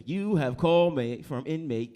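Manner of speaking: automated prison call voice. Acted. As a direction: draw it out like a recorded jail call. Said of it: "they call you on the phone. It's like".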